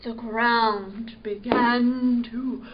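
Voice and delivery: ordinary delivery, nothing marked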